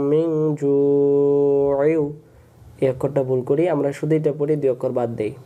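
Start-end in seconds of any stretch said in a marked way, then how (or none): 0.1-2.0 very slow, melodic drawn-out recitation; Quran recitation. Shown as a demonstration. Teacher modelling the correct words for students, slow chant. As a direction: stretch মিন and জু'ইউ in a long chant. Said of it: "recites word as model".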